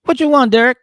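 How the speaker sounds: put-on high, loud sassy girlfriend voice; mock girlfriend. Monique pulling up to meet Derek, sassy mock voice. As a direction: snap the question up high. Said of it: "she's like".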